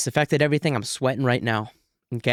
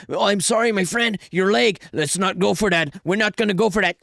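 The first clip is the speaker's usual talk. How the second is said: mocking polite fighter; 5 semitones higher than usual. Mocks fighter's misplaced politeness.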